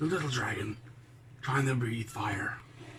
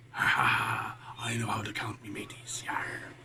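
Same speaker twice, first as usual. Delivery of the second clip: pirate chuckle, then a quiet whispered aside; a pirate whispering to his mateys; pirate laugh and hushed whisper; 5 semitones lower, 5 dB quieter than usual. Pretend.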